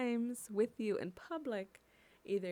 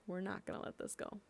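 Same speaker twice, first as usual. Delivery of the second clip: softer and faster, almost under the breath; quiet, quick repeat; 7 dB quieter, faster than usual. Real, not acted. Community voice vowing to officials, muttered quickly. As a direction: mutter it fast like a vow to yourself. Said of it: "and we're not gonna let this go".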